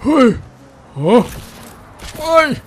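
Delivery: excited whoops. Real, not acted. Thrill ride exclamations.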